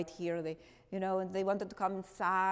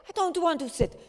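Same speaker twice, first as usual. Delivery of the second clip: loud, higher defiant refusal; 7 semitones higher, 8 dB louder, faster than usual. Retold or imitated. Miep refusing Otto, loud defiant higher voice. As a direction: push back hard, higher and louder.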